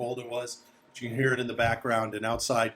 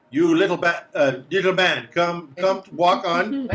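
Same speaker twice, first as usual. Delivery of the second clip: raised, higher guide voice; wary ordering along; 6 semitones higher, 6 dB louder than usual. Acted. Guide to the little man he watches, wary ordering. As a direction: herd the little man onward.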